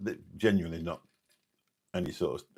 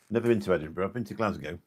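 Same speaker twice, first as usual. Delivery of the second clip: normal talking voice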